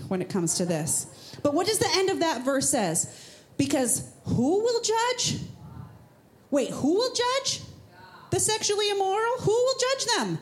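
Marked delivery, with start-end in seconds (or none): 3.6-7.6 high, drawn-out mock puzzlement; a hammed-up wondering question; mock surprise. Mock surprise about who judges. 8.3-9.4 high, quick feigned question; "The sexually immoral?" rising high in mock disbelief; mock surprise. Mock surprise about who judges. 9.4-10.4 a hammed-up wondering question; mock surprise. Mock surprise about who judges.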